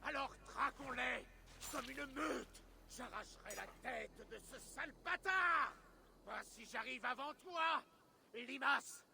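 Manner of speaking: battle taunt. Acted. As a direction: taunt a rival warrior in the chase. Warriors racing after the enemy. (provocation guerrière, voix haut perchée)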